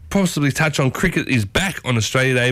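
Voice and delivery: normal talking voice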